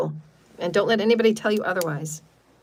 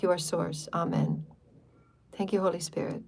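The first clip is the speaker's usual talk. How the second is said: calm, hushed prayer to the angels; guided healing prayer; 3 dB quieter than usual. Acted. Healer praying over her listeners, calm and hushed. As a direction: pray softly over the listeners.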